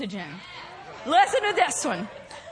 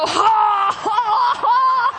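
loud, high forced laugh; faked laugh; 5 semitones higher, 5 dB louder, slower than usual. Shown as a demonstration. Preacher showing the body can't tell, faked laugh. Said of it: "when you're faking it".

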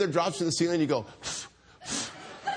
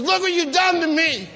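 high, loud, blaming wail; furious accusation; 12 semitones higher, 5 dB louder than usual. Retold or imitated. Wife to husband mid-contraction, furious accusation. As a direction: play the wife in labor blaming her husband.

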